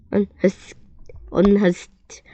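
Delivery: plain reading voice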